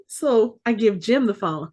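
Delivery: ordinary talk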